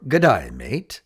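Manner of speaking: Australian G'day, mate, rising on mate; an Australian friend saying hi, in an Australian accent. Shown as a demonstration. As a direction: greet like a friendly Aussie.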